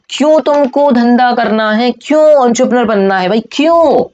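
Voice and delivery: emphatic demanding question. Shown as a demonstration. Teacher challenging students on why start a business, emphatic demand. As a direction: stretch each question out at a high pitch. Booming rhetorical question to class.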